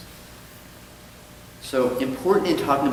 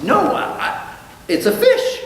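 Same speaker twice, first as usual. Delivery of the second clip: much higher, squeaky panicked protest; panicked denial; 6 dB louder than usual. Acted. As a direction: caught out and flustered. Goldstein panicking, joke.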